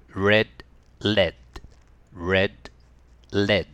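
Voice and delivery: Red with a curled R, lead with a tongue-tip L; R and L minimal pairs. Shown as a demonstration. Teacher drilling red and lead. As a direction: keep R and L distinct. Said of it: "はい、一緒に。".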